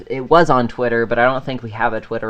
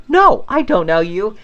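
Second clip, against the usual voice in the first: higher, louder mock protest; baffled denial; 7 semitones higher, 5 dB louder than usual. Friend answering the stranger at his door, mock baffled. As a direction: act out an emphatic denial.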